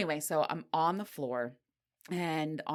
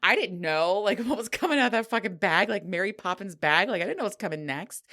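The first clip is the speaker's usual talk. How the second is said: fast, loud excited rush; bubbling over with excitement; 4 semitones higher, 6 dB louder than usual. Real, not acted. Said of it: "I'm so excited".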